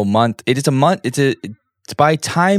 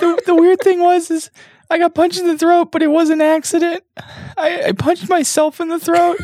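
fake Jan voice. Cohost fakes absent Jan's voice for a joke, high put-on voice. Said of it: "Jan, you don't sound like that".

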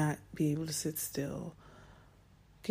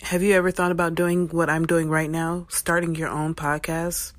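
loud, projected sponsor pitch; host's upbeat, louder promo read; podcast ad read; 8 dB louder than usual. Acted. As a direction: sell it to listeners with full voice. Host reads a podcast sponsor ad.

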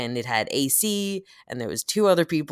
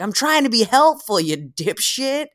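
higher, louder mock rude New Yorker; 10 semitones higher, 6 dB louder than usual. Explaining New York, mock gruff driver voice. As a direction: snap it like a gruff helpful local. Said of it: "where he's like".

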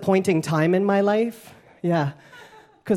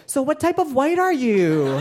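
nosy question; 6 semitones higher than usual. Retold or imitated. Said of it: "be like".

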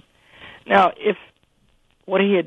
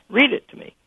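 stern order; 10 semitones higher, 4 dB louder than usual. Retold or imitated. President ordering student to read.

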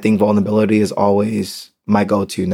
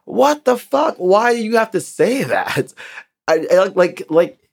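much higher, shocked what the fuck; incredulous high squeal of outrage; outraged disbelief; 10 semitones higher than usual. Real, not acted. Hearing the dog owner's joke, a high shocked outburst.

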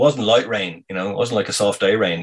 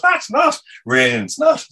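much higher, louder protest; excited denial; 4 dB louder than usual. Retold or imitated. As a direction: refuse it, voice shooting up. Arguing back about the bite.

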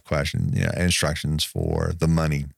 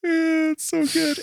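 1 excited gush; slower than usual. Real, not acted. Gushing over the fight scene.

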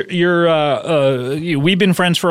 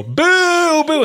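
booing; 13 semitones higher than usual. Retold or imitated. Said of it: "and I go".

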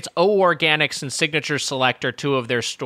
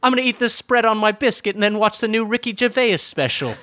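higher, hammed-up British bloke; mock British accent; 6 semitones higher than usual. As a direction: talk like a cartoon Brit planning his evening. Continues British bit.

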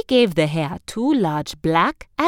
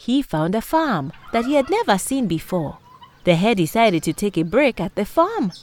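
plain reading voice

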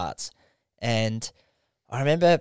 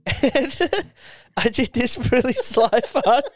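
high-pitched, giggling confession; words bubbling through laughter; laughing while talking; 11 semitones higher than usual. Real, not acted. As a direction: barely get it out for laughing. Cracking up at own story.

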